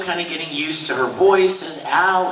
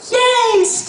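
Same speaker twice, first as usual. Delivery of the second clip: put-on high, excited kid cheer; 5 dB louder than usual. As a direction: squeal it like young Al. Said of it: "Al turns around and goes".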